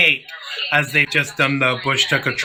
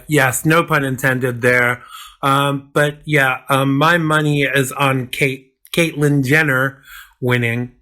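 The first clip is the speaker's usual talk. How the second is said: ordinary talk, nothing marked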